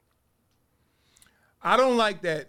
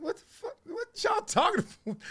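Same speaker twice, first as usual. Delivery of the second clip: high-pitched mock bafflement; acted disbelief. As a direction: play it incredulous, pitched way up. Acted baffled reply to left-right talk.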